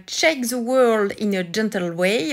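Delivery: slow, self-mocking French accent on the English title. Shown as a demonstration. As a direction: read the English title slowly with a heavy French accent, joking at yourself. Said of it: "avec mon accent very good English".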